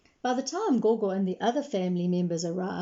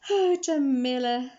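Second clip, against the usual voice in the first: sighing; 5 semitones higher, 4 dB louder than usual. Acted. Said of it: "sighed Mama".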